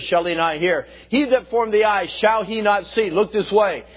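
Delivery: high, loud scripture declamation; full-force preaching. Acted. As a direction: hammer out verse after verse at full voice. Preaches rapid chain of verses.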